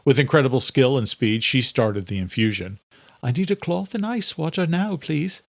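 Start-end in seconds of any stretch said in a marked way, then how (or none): 3.2-5.4 put-on lightly higher young woman's voice; urgent request from a nurse; lightly voiced healer, urgent; Phoebe's voice. A narrator's reading. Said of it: "Phoebe said".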